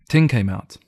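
ordinary delivery, nothing marked

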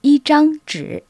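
yi zhang zhi spoken in Mandarin with its tones; Mandarin phrase. Shown as a demonstration. Teacher models a Mandarin measure word phrase. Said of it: "Il se dit en chinois".

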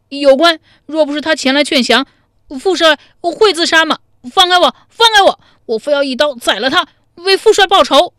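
哭喊着，声音又高又响，语速很快; 拼命挣扎着哭喊要报仇; 哭着喊. A narrator's reading. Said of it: "一边哭着说". (挣脱哥哥，哭喊着要报仇)

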